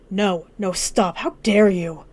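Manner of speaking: angry, forceful objection; indignant protest. Acted. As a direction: cut in hard, outraged at what was said. Acted quarrel, cuts him off.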